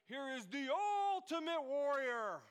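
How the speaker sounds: lightly voiced hype announcer; ring announcer. Preacher hyping the congregation with a mock announcer call. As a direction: draw it out like a fight introduction.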